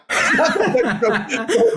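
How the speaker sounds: high, sudden laugh breaking out; burst of laughter. Real, not acted. Bursts out laughing mid-story.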